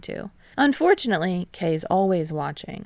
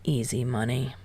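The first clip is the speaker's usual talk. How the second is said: snorting; 6 semitones lower, 5 dB quieter than usual. A narrator's reading. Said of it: "Kei snorts".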